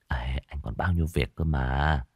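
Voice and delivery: soft breathy whisper. A narrator's reading. Boyfriend whispers he has work to do. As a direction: whisper it close.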